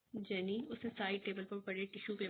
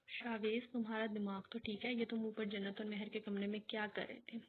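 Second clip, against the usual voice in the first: plain reading voice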